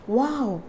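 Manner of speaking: "wow" with lips rounded, said softly; quiet puckered "wow"; soft whistled W. Shown as a demonstration. Teacher shows lips on a muted video, soft W. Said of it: "I'm whistling here for W words".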